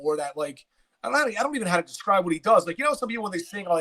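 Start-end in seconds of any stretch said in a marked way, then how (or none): none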